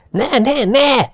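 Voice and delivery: very high, desperate cry; screamed at full throat. A narrator's reading. Haggerty runs back to stop them, crying out desperately. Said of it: "torka szakadtából ordította".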